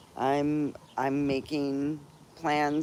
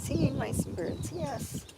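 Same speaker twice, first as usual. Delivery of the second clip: cooing to animals; 10 semitones higher, 6 dB quieter, slower than usual. Pretend.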